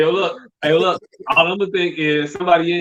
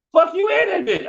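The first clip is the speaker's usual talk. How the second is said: squeaky pitched-up sass; high mock voice; 14 semitones higher than usual. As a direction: toss it off in a high snippy voice. Voicing a girl's dirty look.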